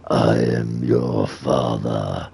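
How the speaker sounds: very deep, slow demon; ominous drawn-out monster voice. Acted. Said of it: "do the demon voice".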